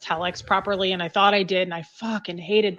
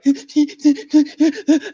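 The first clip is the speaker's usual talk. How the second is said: loud, high panicked sob-gasps; imitated hysterical gasping; 7 semitones higher, 7 dB louder than usual. Freaked-out girl phoning her dad, gasping hysterically. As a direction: gasp in bursts, unable to get words out. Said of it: "I couldn't even talk".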